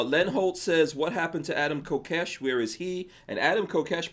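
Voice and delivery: ordinary talk, nothing marked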